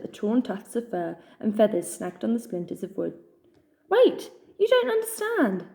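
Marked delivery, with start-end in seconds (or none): 3.9-5.6 much higher, slow desperate protest. A narrator's reading. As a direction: plead while struggling in bonds. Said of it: "Hans protested".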